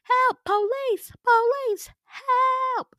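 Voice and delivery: high-pitched panicked scream for help. Retold or imitated. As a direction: shriek for help as if sinking into a mirror. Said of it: "So I'm screaming".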